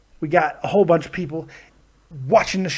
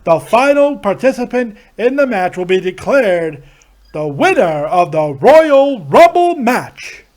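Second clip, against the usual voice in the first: drawn-out ring announcer, building louder; Howard Finkel; 3 semitones higher, 5 dB louder, slower than usual. Acted. Announcer declares how the Rumble is won, grand swells. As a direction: swell up to a big slow finish. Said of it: "your Howard Finkel impressions".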